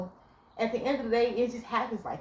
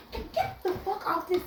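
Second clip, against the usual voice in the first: high, laughing mock snap; hammed-up furious girlfriend; mock angry outburst; 11 semitones higher than usual. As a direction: play the words she held back. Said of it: "I didn't say".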